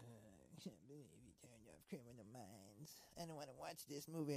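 put-on grumbling voice, dragged out; mock a whiny complaint; exaggerated imitation of a complainer; imitating partner's complaint. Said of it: "And now you're like".

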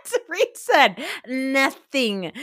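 slow, drawn out through laughter; words stretched while cracking up; laughing. Real, not acted. Laughing at a cast member.

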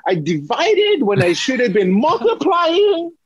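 squeaky, strained and high, pleading; panicked high wail. Retold or imitated. Student trainer confessing the tape mistake to Eric, panicked high wail.

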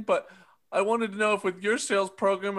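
normal talking voice

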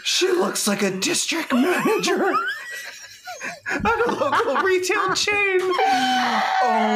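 high-pitched cry through laughter; laughing outburst. Real, not acted. Co-host reacting to a shocking photo, laughing outburst. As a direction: blurt it out while cracking up.

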